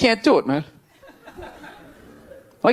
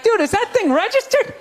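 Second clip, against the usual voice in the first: much higher, shouting, freaked-out buddy; panicked pal yelling about the gun; 7 semitones higher than usual. Retold or imitated. Imagined friend shouting at rapper about his gun, alarmed.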